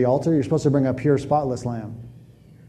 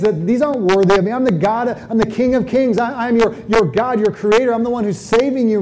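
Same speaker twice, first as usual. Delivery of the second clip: God rebuking; 6 semitones higher than usual. Retold or imitated. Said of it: "he's using that analogy to say".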